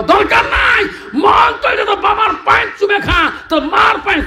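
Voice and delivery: high-pitched, fast preaching shout; shouted exhortation. Real, not acted. Preacher urging listeners to kiss parents' feet, high rapid shout. Rebuking listeners who honour only pir.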